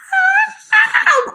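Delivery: very high wordless cry; mock meltdown shriek; fired-up scream. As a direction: let out a high, fired-up squeal. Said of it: "when I was like".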